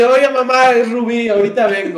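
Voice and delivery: loud mock announcement to a parent; calling out to mom. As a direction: call it out through the house. Acted calling out to his mother.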